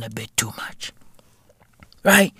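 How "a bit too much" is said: whispered punchline. Acted.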